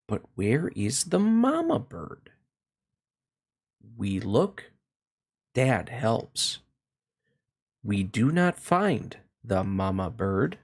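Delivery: ordinary delivery, nothing marked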